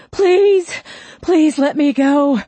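very high, loud begging; panicked pleading. A narrator's reading. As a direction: cry out a desperate repeated plea. Handcuffed man pleading for release.

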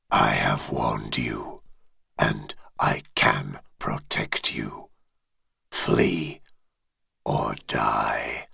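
very low, distant dragon voice; deep dragon voice. Acted. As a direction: speak as an ancient dragon, far below. Dragon's final warning.

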